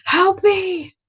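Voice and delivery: very high-pitched mock scream for help; imitated woman's scream. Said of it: "screaming for their life".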